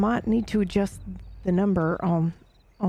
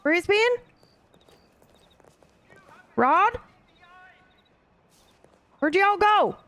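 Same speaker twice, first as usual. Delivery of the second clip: calling out; 13 semitones higher, slower than usual. Real, not acted. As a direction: call for friends who vanished. Calling lost companions.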